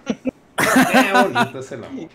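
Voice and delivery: high, slow, laughing swoon. Pretend. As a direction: laugh through it, drawn out and high.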